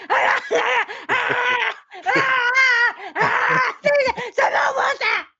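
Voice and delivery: high, loud, wailing ahs and please; frantic pleading gasps. Retold or imitated.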